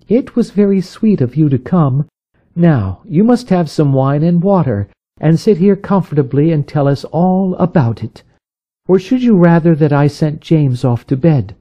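wife comforting her distressed friend. A narrator's reading. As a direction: reassure her gently.

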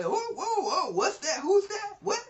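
high, yelping mock alarm with a laugh; startled disciples. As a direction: jump out of your skin at a ghost. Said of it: "being startled and frightened".

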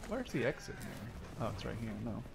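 ordinary talk

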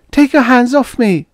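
loud, very high female protest; protesting cry. Acted. Girl wrenching free of father's grip, protesting cry.

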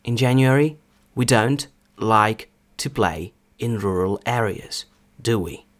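slow, word-by-word example reading; повільно. Shown as a demonstration. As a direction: read it out slowly with pauses between phrases. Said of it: "Приклади буду зачитувати спочатку повільно". (повільне навчальне читання)

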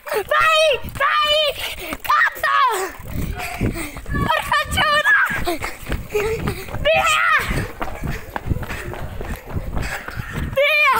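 high excited shouts; explosive italian cursing. Real, not acted. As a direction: yell while charging in. (imprecazioni urlate eccitate)

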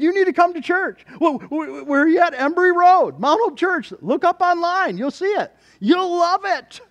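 high, loud excited back-and-forth; eager church invitation. Acted. Preacher inviting his realtor to church, high and excited. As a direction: urge them along, pitched high and loud.

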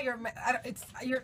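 usual speaking voice

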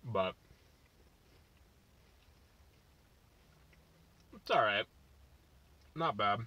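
speaking between bites; talking while chewing. Real, not acted. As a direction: mouth partly full of food. Seen 1: Bites taco at -7; cheeks bulge, jaw chewing through the words.

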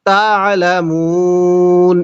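তা'লামুন drawn out, very slow; slow model recitation. Shown as a demonstration. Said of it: "এরকম ভাবে শিখব।".

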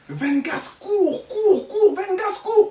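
high, loud urgent calls; friends yelling. Retold or imitated. Trapped friends calling Vengas to run, high loud yell. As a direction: shout it like you're trapped in a room. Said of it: "j'entends mes potes qui crient".

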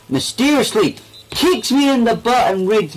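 high loud startled yelp; startled squeal. Real, not acted. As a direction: squeal as you get kicked. Hit from behind in game.

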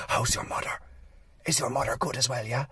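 very soft, hushed small talk; whisper. Acted. Pharmacist asking politely after a customer's mother, whispered. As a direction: drop right down to a discreet whisper. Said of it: "they'll whisper the right thing".

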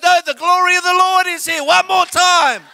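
a worshipper swept away, won't stop; mock ecstatic mum. Son imitating his mum refusing to stop the song, mock fervent.

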